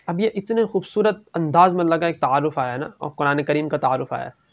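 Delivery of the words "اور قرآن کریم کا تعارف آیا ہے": ordinary talk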